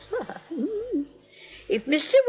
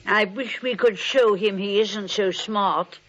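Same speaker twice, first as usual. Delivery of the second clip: ordinary talk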